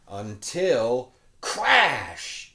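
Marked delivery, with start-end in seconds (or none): 1.4-2.5 crash. A narrator's reading. Cart crashes into shelf.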